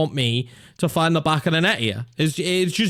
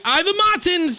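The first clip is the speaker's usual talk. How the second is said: excited shout. Real, not acted. Chance on goal, calling out.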